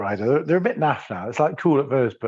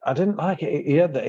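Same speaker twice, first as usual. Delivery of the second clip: usual speaking voice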